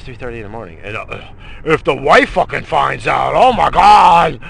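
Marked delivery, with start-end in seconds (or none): 3.4-4.4 slow, loud "oh my God"; panicked shout. Real, not acted. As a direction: dread exploding out loud. Panicking at wife finding out.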